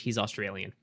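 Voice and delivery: ordinary talk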